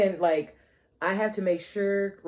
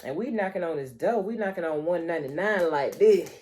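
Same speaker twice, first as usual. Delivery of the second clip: normal talking voice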